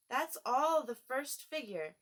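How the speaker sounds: usual speaking voice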